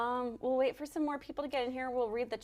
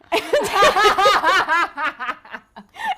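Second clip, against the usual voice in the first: big loud burst of laughter; 8 semitones higher, 13 dB louder than usual. Real, not acted. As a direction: crack up loudly. Laughing at awkward reply.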